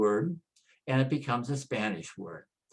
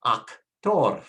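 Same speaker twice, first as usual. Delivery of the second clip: actor as ahk-TOR, weight on TOR; emphatic ahk-TOR for actor; Spanish last-syllable stress; 4 dB louder than usual. Shown as a demonstration. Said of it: "stress on the last syllable".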